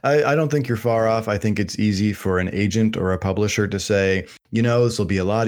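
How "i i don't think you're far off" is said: usual speaking voice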